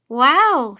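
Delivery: loud, swooping exclamation of wonder; amazed. A narrator's reading. Girl seeing the go-kart wheeled out, amazed. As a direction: let the word burst out, impressed.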